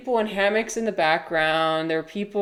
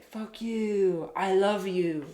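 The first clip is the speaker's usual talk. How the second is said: mock intense hippie; 2 semitones higher, much slower than usual. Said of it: "He'd be like".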